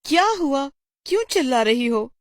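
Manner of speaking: startled teacher. Acted. Teacher reacts to scream.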